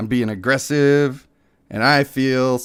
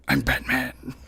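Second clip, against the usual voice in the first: Batman; 7 dB quieter than usual. Retold or imitated. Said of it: "Was he Batman?".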